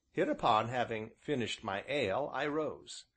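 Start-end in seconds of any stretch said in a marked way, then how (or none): none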